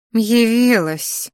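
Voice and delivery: slow spiteful sneer; snide sneer. A narrator's reading. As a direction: spit the word out with contempt. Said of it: "выплюнула ехидно Криштия".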